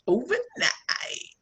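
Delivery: breathy whispered punchline; whispered aside. Real, not acted. Telling the audience she became a celebrity overnight, whispered. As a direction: whisper it like a secret.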